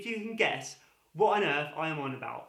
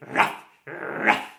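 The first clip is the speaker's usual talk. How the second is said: dog barking; 4 semitones higher, 10 dB louder than usual. Shown as a demonstration. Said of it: "And what is the dog doing?".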